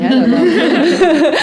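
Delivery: high, lazy laughing shrug; bored. Acted. Bruto doesn't care about the party, lazy laughing shrug. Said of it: "He's like".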